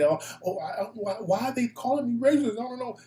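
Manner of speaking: high, fake-confused caller voice; mock clueless caller. Host imitating the caller who played dumb, mildly whiny. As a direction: play dumb, all innocent and lost.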